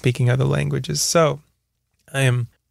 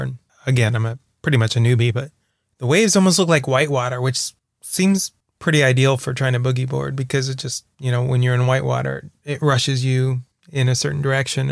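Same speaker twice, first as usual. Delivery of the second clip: ordinary talk, nothing marked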